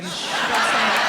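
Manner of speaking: a laughing, pressing shush; urgent hush. Retold or imitated. Comic shushing Arabs who pass themselves off as Cuban. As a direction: hush them before they give it away.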